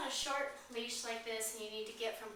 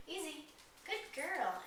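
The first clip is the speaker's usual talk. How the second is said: higher, sing-song praise for the puppy; praising dog voice; 6 semitones higher than usual. Shown as a demonstration. Trainer to puppy on the leash, higher praising voice. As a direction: coax the puppy gently, pitched up. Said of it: "I just stop and I say".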